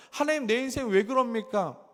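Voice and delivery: high, loud protest to God; resentful complaint. Acted. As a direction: act out a believer crying out in grievance. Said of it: "라고 원망하죠".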